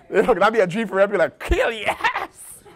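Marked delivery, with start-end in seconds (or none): 1.4-2.6 high, slow, laughing mock cheer of joy; mock delight. A kale lover sees the harvest, mock delight. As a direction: squeal it out like kale is a treat.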